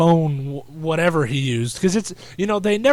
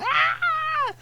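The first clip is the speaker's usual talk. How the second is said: long high-pitched mock scream; imitated falsetto squeal; hype man scream. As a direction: shriek like an excited onlooker. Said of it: "the one hype man guy".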